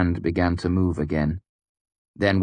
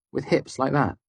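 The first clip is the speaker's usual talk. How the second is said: flat angry quip; 7 semitones higher than usual. A narrator's reading. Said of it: "Her tone was flat and angry".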